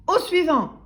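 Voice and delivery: high, loud shout; yelling milkman. A narrator's reading. Milkman dismissing timid customer, yelling. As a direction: holler for the next customer. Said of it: "hurla la laiti".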